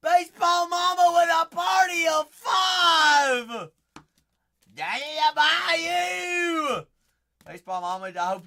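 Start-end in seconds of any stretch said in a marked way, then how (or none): 0.0-3.7 high, loud, stretched welcome shout; roaring welcome for a new viewer; hype shout. Acted. Greeting a viewer joining. 4.7-6.9 high, loud shouted exclamation; shouting at a viewer in delight; excited shout. Acted. Reacting to the viewer's group.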